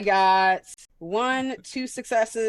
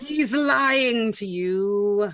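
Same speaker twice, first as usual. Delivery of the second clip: slow, drawn-out sinister accusation, higher pitched; Palpatine; 4 semitones higher, 4 dB louder, much slower than usual. Acted. Turning Wendy against the captive, slow Palpatine menace. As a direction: draw it out like an evil manipulator. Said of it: "[name] turned into Palpatine".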